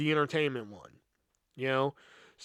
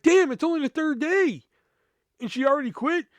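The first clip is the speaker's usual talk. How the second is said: surprised; 10 semitones higher, 3 dB louder than usual. Retold or imitated. Reacting to the manager quitting.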